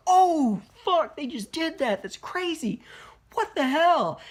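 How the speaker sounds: high, loud startled exclamation; shocked laughing outburst. Retold or imitated. Reviewer reliving a brutal gore scene, excited shout. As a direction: blurt it out like you can't believe what you just saw. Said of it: "I was laughing".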